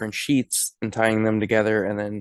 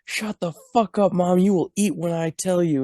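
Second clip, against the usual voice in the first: higher, snapping put-on voice; a put-on daughter telling her mom off; mock angry daughter; 8 semitones higher than usual. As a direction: scold your mother, hammed up. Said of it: "And she's like".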